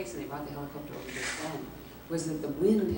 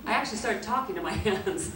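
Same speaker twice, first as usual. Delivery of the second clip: ordinary talk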